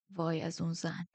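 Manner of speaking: soft exasperated mutter; under his breath. A narrator's reading. Man grumbles about the old woman, under his breath. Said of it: "زیر لب گفت".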